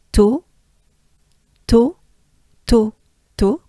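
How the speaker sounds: to clipped and high, like Toto; French to. Shown as a demonstration. Teacher drills to for learners, bright. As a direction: tap out to crisply. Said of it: "comme Toto".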